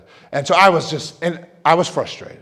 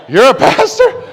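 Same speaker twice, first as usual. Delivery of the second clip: loud, high mock disbelief; incredulous; 10 semitones higher, 9 dB louder than usual. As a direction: exaggerate a shocked question for laughs. Mimics people's disbelief, gets laugh.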